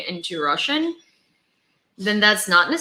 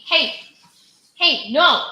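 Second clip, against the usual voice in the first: sharp raised call to the dog; loud warning to a misbehaving puppy; 8 semitones higher, 7 dB louder, slower than usual. Real, not acted. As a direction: stop the dog in its tracks, loud and high. Scolding the dog Cindy.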